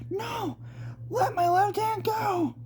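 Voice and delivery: very high, squealing no; struggling to free her hand, voice shooting up; panicked plea. Acted. Jomi begs Samuel to let her hand go, squealing high. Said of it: "said Jomi as she squirmed".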